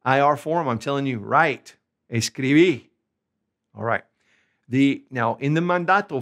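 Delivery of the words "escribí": "escribí" stressed on the last i; final-syllable stress. Shown as a demonstration. Teacher giving the vos command to write, stress on the end of "escribí". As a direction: drive through to the final i of "escribí". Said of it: "put an accent on the last vowel".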